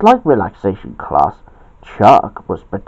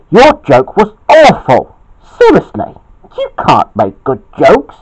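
mock-angry yell, loud and jumping high; 5 semitones higher, 5 dB louder than usual. A narrator's reading. Bobby rows with Chuck over the party, angry yell. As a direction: raise the voice in a cross, high outburst. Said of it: "yelled Bobby".

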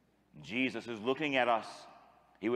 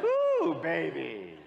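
high, loud ooh of delight; playful whoop; 4 semitones higher, 5 dB louder, much slower than usual. Real, not acted. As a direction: cheer the crowd on. Playful exclamation to crowd.

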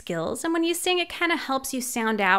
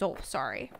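low, soft sheepish moose; a much lower apologetic mumble; 13 semitones lower, 11 dB quieter, faster than usual. Acted. The moose apologizes for being on the wrong page. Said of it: "He says".

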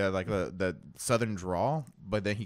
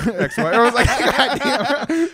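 high loud laughter through the words; can barely get it out for laughing; 13 semitones higher, 12 dB louder, slower than usual. Real, not acted. Friend cracking up retelling teacher's bitter joke.

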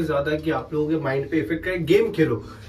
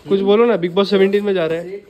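loud, raised-pitch coaxing; playful pestering; 4 semitones higher, 8 dB louder than usual. Pretend. Vlogger asking friends to react to Bigg Boss news, playful pestering. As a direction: call out for a reaction, louder and higher.

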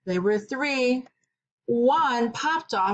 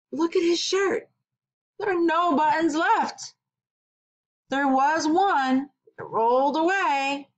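surprised; 7 semitones higher than usual. A narrator's reading. Reacting to no buttons left.

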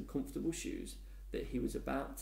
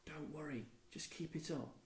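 soft hushed aside, much softer; whispering; 3 semitones higher, 10 dB quieter than usual. A narrator's reading. Lisa urging Dennis in secret, in a soft whisper. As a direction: murmur it so only he hears. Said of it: "before whispering to Dennis".

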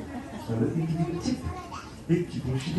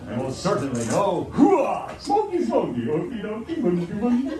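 playful sing-song; 7 semitones higher than usual. Acted. Playful list of forbidden things.

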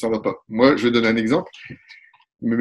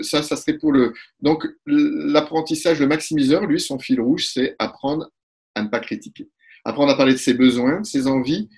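normal talking voice

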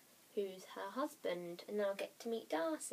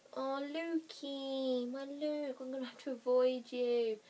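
high, drawn-out mock-mournful whine; mock lament; 5 semitones higher, slower than usual. Fan to Lukey she must avoid, mock lament. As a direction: wail it softheartedly, stretched out and high.

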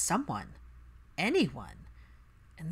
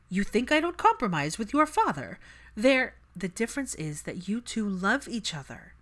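a touch of an exasperated mother, louder; mother snapping back about compromise, mildly raised; mother's voice; 4 semitones higher, 6 dB louder than usual. Acted. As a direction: push back hard at your daughter.

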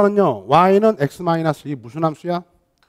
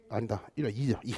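much softer, lower aside to himself; quiet, low correction under the breath; muttered self-correction; 8 semitones lower, 15 dB quieter than usual. Real, not acted. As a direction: drop the voice right down to fix a slip. Correcting own slip mid-example.

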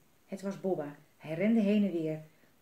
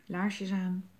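usual speaking voice

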